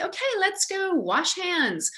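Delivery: high, drawn-out, breezy suggestion; casual redirect. Shown as a demonstration. Adult redirecting kids to wash hands, casually. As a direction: suggest it casually in a lifted, unhurried voice. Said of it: "if you just really casually say".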